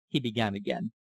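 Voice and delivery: usual speaking voice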